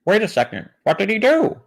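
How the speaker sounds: high, rising hammed-up alarm; Kent Hovind. Kent pressing the man for details, overacted high-pitched alarm. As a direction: send the question soaring in mock suspense. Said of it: "this story is Kent Hovind".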